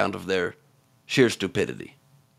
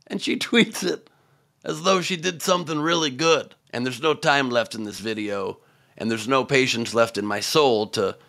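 usual speaking voice

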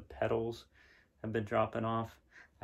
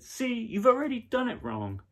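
put-on bossy little girl; 13 semitones higher, 5 dB louder than usual. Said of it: "But she said".

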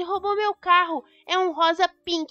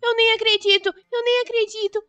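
louder, much higher squeals of joy; thrilled the car came back; joyful disbelief; overjoyed; 7 semitones higher, 7 dB louder than usual. Acted.

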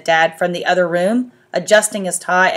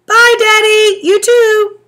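put-on little boy voice; 9 dB louder, slower than usual. A narrator's reading. Little brother.

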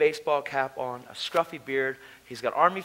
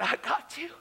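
tearful protest; 11 semitones higher, 5 dB quieter than usual. Retold or imitated. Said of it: "And I cried".

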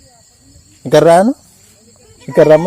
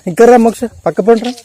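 calling out; 6 semitones higher than usual. Real, not acted. Calling child to come aside. (గొంతు పెంచి పిల్లను పిలుస్తూ)

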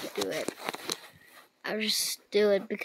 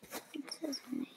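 normal talking voice

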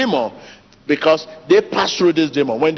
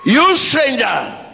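shouting faith healer; 11 semitones higher, slower than usual. Real, not acted. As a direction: roar it out at the demon. Deliverance prayer, commanding demons.

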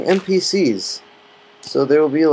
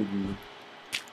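normal talking voice

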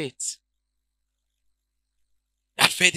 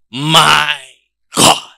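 normal talking voice

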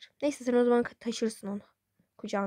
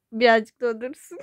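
ordinary delivery

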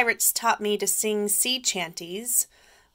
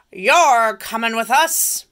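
pirate captain; 3 semitones higher, 9 dB louder than usual. Acted. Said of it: "Braidbeard told me".

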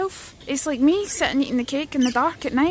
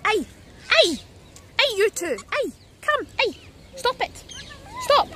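scolding birds; 10 semitones higher than usual. Real, not acted.

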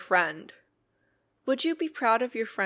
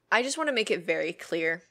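ordinary talk, nothing marked

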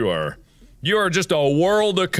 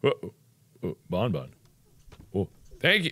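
ordinary talk

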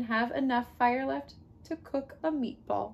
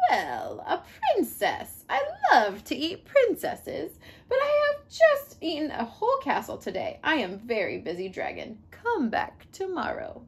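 grumpy dragon; 4 semitones higher than usual. Acted. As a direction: brush her off like a bored dragon. Dragon voice in read story.

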